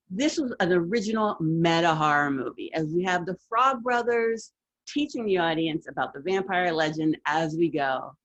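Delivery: ordinary talk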